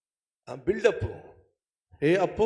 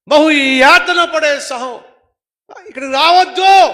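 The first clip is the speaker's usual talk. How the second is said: shouting from hell; 14 semitones higher, 16 dB louder than usual. Acted. Rich man in hell shouting to his brothers, anguished shout. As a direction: cry out the brothers' danger. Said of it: "ధనవంతుడు నరకంలో అరుస్తున్నాడు".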